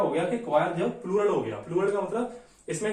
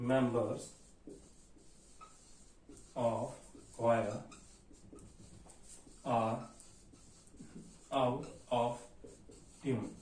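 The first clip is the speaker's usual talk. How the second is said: slow soft dictation; 7 semitones lower, 10 dB quieter, much slower than usual. Shown as a demonstration. Teacher dictates while writing.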